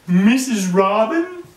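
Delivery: high, loud and slow, mouth stretched wide; a baby frog asking Missus Cow, wide-mouthed, high and loud. A narrator's reading. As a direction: stretch every word out like a frog with a huge grin. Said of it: "that's the way they talked".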